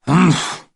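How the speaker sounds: a short scornful humph through the nose; disapproving humph. A narrator's reading. Mummy doubting Daddy's excuse, scornful humph. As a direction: huff it out, unimpressed. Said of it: "And Mummy said".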